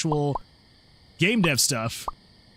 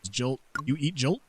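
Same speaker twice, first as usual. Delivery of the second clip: normal talking voice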